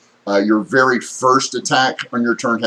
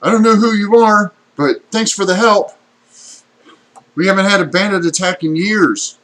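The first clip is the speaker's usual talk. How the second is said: high, loud villager calling out; spear-carrying villager; 10 semitones higher, 5 dB louder than usual. Acted. Villager thanks strangers for help against bandits, high and loud. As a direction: pitch it up and project as a grateful villager. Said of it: "And goes".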